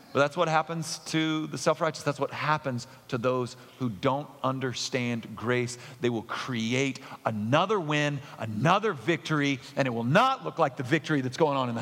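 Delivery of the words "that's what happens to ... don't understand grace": normal talking voice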